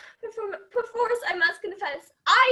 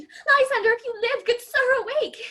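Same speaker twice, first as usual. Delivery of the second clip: higher, louder urgent call; 4 semitones higher, 6 dB louder than usual. Acted. Helena calling Lysander to wake up, loud and urgent. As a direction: shout to wake him.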